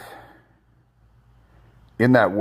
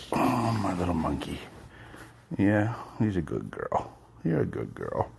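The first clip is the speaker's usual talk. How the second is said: talking to a dog; 6 semitones higher, 8 dB quieter than usual. Real, not acted. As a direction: coo at the puppy. Baby talk to his dog.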